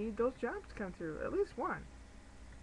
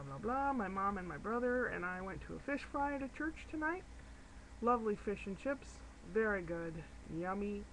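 normal talking voice

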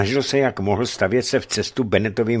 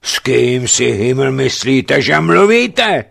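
slow, louder, rising roar; furious roar, climbing higher; 5 semitones higher, 7 dB louder, slower than usual. A narrator's reading. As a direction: roar at the insolent lieutenant. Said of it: "zařval".

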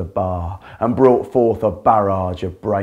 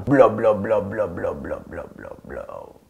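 blob chant dying away to a whisper; fading blob chant; 2 semitones lower, 5 dB quieter than usual. Acted. The Blob bouncing off, its blob sound fading out. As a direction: let the blobs fade to nothing.

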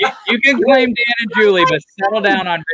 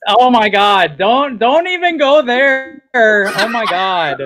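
put-on Valley Girl accent; 3 semitones higher than usual. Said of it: "Valley Girl accent".

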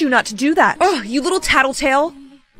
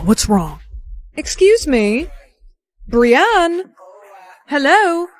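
ordinary delivery

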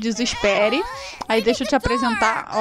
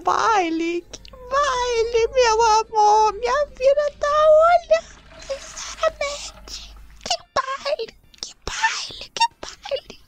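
hammed-up high wailing sob on baile; fake-cry it, climbing higher and breaking into a whisper; mock sobbing; 11 semitones higher, slower than usual. Player fake-crying to her game husband about the ball, high wail.

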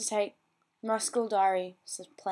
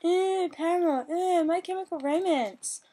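higher, whiny mock of forum moaners; mocking complainers; 7 semitones higher than usual. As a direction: sneer at the band names. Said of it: "people are complaining".

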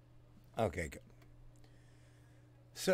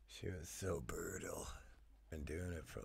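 hushed admiring whisper; soft whispered aside; 3 semitones lower, 13 dB quieter than usual. Real, not acted. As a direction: breathe it under the music. Whispered aside while watching.

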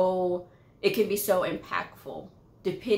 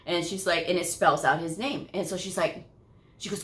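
usual speaking voice

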